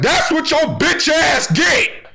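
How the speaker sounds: high, slow mocking heckle; taunting from the sideline. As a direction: jeer at him like a gloating spectator. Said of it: "I would've been up there on the sideline like".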